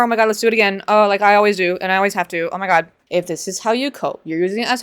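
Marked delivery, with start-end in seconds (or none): none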